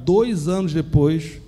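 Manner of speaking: "dois anos depois" drawn out slowly, each word weighed; slow emphatic repeat. Real, not acted. Preacher to congregation, stressing how long he waited, slowly.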